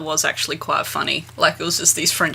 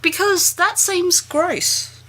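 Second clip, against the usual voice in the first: high, slow drawn-out disgust; slow, high, grossed-out drawl; 11 semitones higher, much slower than usual. Real, not acted. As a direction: stretch it out, grossed out. Disgusted reaction.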